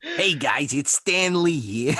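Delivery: sleazy, drawn-out greeting; Stan Lee impression. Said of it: "I make it sound like sleazy Stan Lee".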